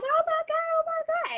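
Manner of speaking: very high, squealed mock panic. Storyteller voicing her friend watching the fight, mock panic. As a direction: imitate a frantic friend in a high voice.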